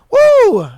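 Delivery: high, drawn-out "woo"; loud whoop. Real, not acted. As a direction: let out a big whoop of relief. Relief after telling his story.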